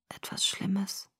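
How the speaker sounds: slow, weak whisper. A narrator's reading. Sick boy admits it is something bad, whispering. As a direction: whisper it as a grim admission. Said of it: "flüsterte er".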